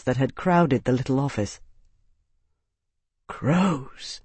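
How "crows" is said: soft breathed curse. Acted. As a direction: breathe the word out. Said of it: "Maximus breathed".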